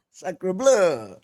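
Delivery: hammed-up French exclamation; mock French, playful; French accent.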